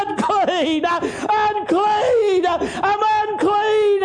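high, drawn-out holler. Acted. Leper hollering to Jesus that he is unclean, high holler. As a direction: holler it out long, in desperate repeats. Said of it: "and he hollers".

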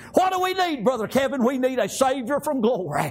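rhythmic, driving revival preaching; full-force country revival sermon; fervent preaching. Real, not acted. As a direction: preach it in chanted bursts with gasped breaks.

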